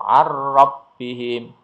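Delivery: ar-rabbihim, slow chant with a rolled double r; straight into the r. Shown as a demonstration. Teacher stressing it is not held, ar-rabbihim straight into r. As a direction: chant ar-rabbihim with no hum before the r.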